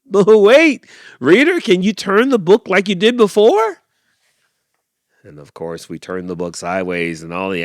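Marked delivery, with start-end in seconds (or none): 0.1-3.8 high, loud, eager shout to reader; loud high call. Acted. Animal calls to reader.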